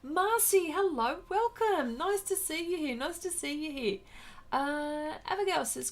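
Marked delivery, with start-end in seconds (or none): 0.0-4.0 high sing-song welcome; excited greeting. Real, not acted. Welcoming Marcy into the livestream, high and excited. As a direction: welcome her warmly and brightly. Greeting viewer joining stream.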